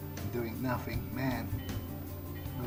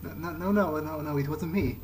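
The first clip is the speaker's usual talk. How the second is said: higher, louder protest; raised voice, mildly alarmed denial; flustered denial; 4 semitones higher, 5 dB louder than usual. Acted. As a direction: protest your innocence in a rush of noes. Hasty denial when accused in game.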